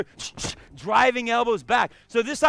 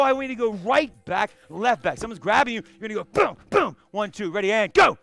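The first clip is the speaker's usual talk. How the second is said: usual speaking voice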